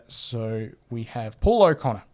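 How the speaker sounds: ordinary delivery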